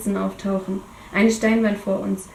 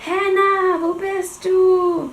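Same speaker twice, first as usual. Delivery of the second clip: calling out; 10 semitones higher, 3 dB louder, much slower than usual. Acted. Kane calling out after crash.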